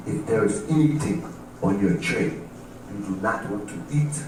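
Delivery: put-on low, soft African-accented request. African inmate asking a cellmate for food, African accent. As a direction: make a solemn offer in an African accent. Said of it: "African dude sits next to me".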